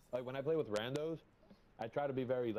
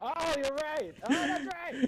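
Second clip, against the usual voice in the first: very high, loud exclamation; sudden realization; 13 semitones higher, 8 dB louder, slower than usual. Real, not acted. Player remembering their own ability, high excited shout. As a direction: burst out as it dawns on you.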